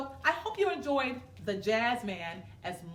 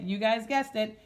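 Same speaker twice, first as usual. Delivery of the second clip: usual speaking voice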